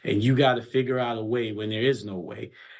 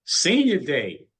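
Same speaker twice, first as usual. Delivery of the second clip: higher, drawn-out cheer; celebratory exclamation; 4 semitones higher, 4 dB louder, slower than usual. Real, not acted. Coach echoing the reporter's senior day, drawn-out celebratory exclamation. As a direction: stretch it out, pitched up.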